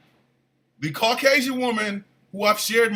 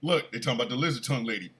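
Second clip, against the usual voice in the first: low, soft, rapid imitation of a wife; quiet conspiratorial nudge; wife's hushed aside; 8 semitones lower, 6 dB quieter, much faster than usual. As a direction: murmur it fast, showing proof. Wife quietly showing evidence.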